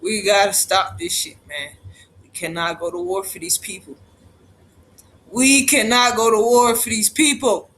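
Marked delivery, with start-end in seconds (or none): 2.2-3.9 low, soft and grave; hushed, somber warning; quiet plea. Real, not acted. Repeats plea, first softly. 5.3-7.7 louder, punching each word; firm, raised insistence; forceful plea. Real, not acted. Repeats plea, now forcefully.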